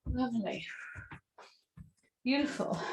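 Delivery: soft whisper of approval; whispered praise. Real, not acted. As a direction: breathe the word out gently. Instructor praising the class softly.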